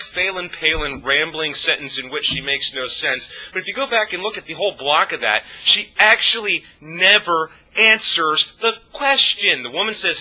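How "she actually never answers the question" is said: high, loud, slow, word by word; exasperated emphasis. Real, not acted. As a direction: hammer out each word slowly and loudly. Emphatic point about a dodged question.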